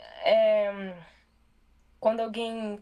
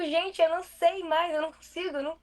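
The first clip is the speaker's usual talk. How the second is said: higher-pitched, exasperated plea to friends; mock helplessness; 6 semitones higher than usual. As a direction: protest helplessly in a raised pitch. Acting out her helpless reply to friends.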